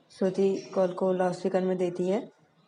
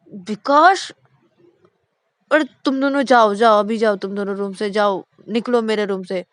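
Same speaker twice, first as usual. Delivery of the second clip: angry yelling; 3 semitones higher, 11 dB louder than usual. Acted. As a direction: chase them out in a temper. Shruti drives brothers out angrily. (ज़ोर से चिल्लाकर भगाना)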